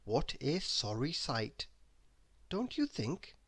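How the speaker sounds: plain reading voice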